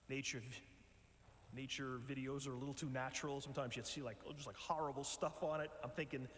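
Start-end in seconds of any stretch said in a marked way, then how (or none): none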